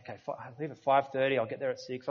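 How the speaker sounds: quick, soft muttering to himself; fast, soft thinking aloud about times; self-talk mutter. Shown as a demonstration. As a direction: rattle it off under your breath. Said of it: "talking like this".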